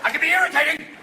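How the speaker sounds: high-pitched comic hijacker threat; hammed-up hijacker demands; mock hijacker. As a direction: menace them absurdly, high and excited. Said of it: "afraid you're gonna go".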